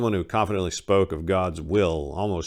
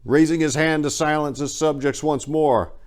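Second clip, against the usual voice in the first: ordinary delivery, nothing marked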